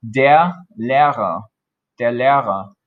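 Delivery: der Lehrer said slowly and clearly; careful German teacher model; German model phrase. Shown as a demonstration. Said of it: "specifically a male teacher".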